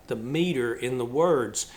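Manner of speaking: ordinary talk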